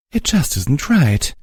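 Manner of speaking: slow, high voice choking on tears; barely holding back tears; choked up. A narrator's reading. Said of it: "Velvet Remedy choked".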